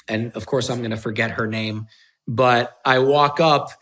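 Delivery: usual speaking voice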